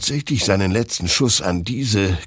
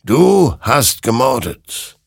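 higher, louder, slow condemnation; grim verdict; 6 semitones higher, 5 dB louder, slower than usual. A narrator's reading. Creature condemns Hektor, slow and grim. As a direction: pronounce the sentence slowly.

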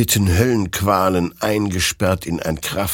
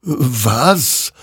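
high, startled question; puzzled surprise; 7 semitones higher, 3 dB louder than usual. A narrator's reading. Hector wonders at the pointing figure, puzzled. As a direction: ask it in baffled wonder. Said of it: "wunderte sich dieser".

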